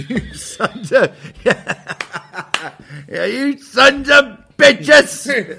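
high, loud, laughing ghost shriek; sputtering foiled villain. Acted. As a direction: shriek curses through laughter. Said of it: "ghost of the murdered man would visit".

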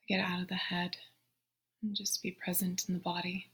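soft, unhurried meditation voice with long pauses; guided meditation. Real, not acted. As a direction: guide them gently into the breath, hushed and spacious. Leads a breathing meditation.

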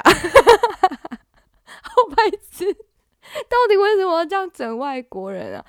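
high, laughing through words. Real, not acted. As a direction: laugh while talking. Laughing at the foreigner prank.

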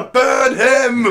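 mock mob shout. Mock audience calling out.

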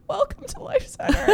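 very high, tearful squeak; mock choked-up welcome, voice cracking upward; imitating cracking voice. Host doing announcements through tears, imitated cracking voice. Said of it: "my voice is, like, cracking".

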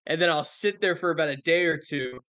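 ordinary talk

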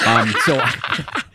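chuckling through the words; half laughing while talking. Real, not acted. Laughing at own story.

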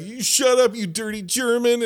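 put-on dad yelling at the boy; imitating the dad. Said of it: "and he's like".

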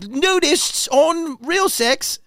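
high, drawn-out mock British announcer; British accent. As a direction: stretch it out slowly in a high put-on British voice. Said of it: "I don't know why I'm British".

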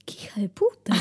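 hushed, appalled whisper; whispered outrage; shocked whisper. Real, not acted. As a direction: mutter it under your breath, scandalized. Shocked aside about spider killing mate.

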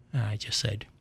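ordinary talk

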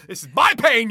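mock outrage. Banning co-host from book talk, mock outrage. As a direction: claim your suffering loudly, half in jest.

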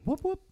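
high whoop-whoop like a police siren; siren imitation. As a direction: mimic the squad car's siren, high and quick.

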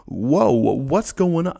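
put-on botched Boston accent, high, loud and slow; a hammed-up, half-failed Boston accent on a stretched whoa; failed Bostonian accent. Zach the Bostonian tasting the stinky fruit, in a botched Boston accent. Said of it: "I can't do a Bostonian accent right now".

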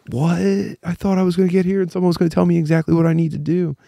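high, flustered inner panic; mock panic. To the wedding staff, a high mock-panicked plea. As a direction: panic at being left with no instructions.

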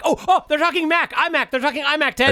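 high, bright burst of excitement; excited. Real, not acted. Dad thrilled the keynote is talking Mac.

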